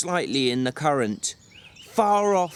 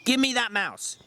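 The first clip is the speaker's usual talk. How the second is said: higher, curt command; brusque; 8 semitones higher than usual. Acted. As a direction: cut him off sharply. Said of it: "he said brusquely".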